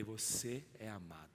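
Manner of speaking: tender. Acted. Jesus telling Pedro he is loved.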